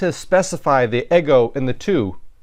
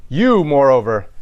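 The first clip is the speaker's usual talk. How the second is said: "You" hit hard, louder; emphatic you; 4 dB louder than usual. Shown as a demonstration. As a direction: stress "You" like a challenge. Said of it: "This is an emphasis".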